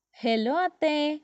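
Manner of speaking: put-on high child's call to an aunt; high-pitched called hello. A narrator's reading. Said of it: "ಕೂಗಿದಳು ಅದ್ವಿ".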